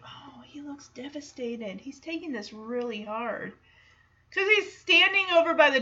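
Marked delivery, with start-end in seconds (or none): 0.0-3.5 hushed aside, imitating a whisper; much softer, voiced mock whisper; retold whisper. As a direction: confide it softly, as if whispering. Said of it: "D J whispers to Stephanie".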